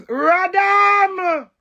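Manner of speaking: loud high yelled outburst; loud exclamation. Real, not acted. Breaking off her story with a loud high yell. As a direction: burst out with it.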